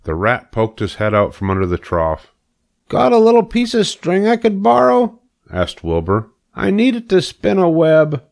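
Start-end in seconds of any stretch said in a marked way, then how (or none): 2.9-5.2 high, louder, asking piglet; a keen pig begging a favour; eager young pig. Acted. Wilbur asking Templeton for string, high and eager. 6.5-8.2 high, louder, asking piglet; a keen pig begging a favour; eager young pig. Acted. Wilbur asking Templeton for string, high and eager.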